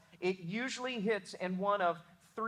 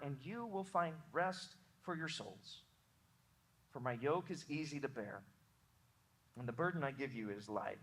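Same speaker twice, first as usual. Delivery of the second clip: softer, unhurried reading of Jesus' invitation; hushed, reverent Bible reading that fades lower; scripture reading; 3 semitones lower, 5 dB quieter than usual. Acted. Reads Jesus' words aloud.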